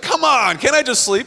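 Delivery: high-pitched, exasperated whine; exasperated pleading. Retold or imitated. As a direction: imitate a worn-out passenger begging. Retold plea from sleepless passenger.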